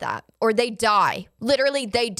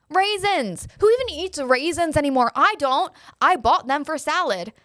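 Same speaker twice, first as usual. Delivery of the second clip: higher, incredulous rant; exasperated outburst; 5 semitones higher than usual. Real, not acted. Ranting to viewers about raisins, exasperated and higher. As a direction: throw up your hands at the raisins.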